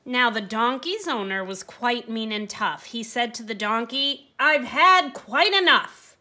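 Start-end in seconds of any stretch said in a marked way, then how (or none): 4.4-6.2 sharp, much louder and higher outburst; huffing anger. Acted. Mean owner losing patience with the donkey, loud huff. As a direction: snap it out like a fed-up mean owner. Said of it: "said with a huff".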